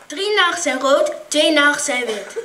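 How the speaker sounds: ordinary delivery, nothing marked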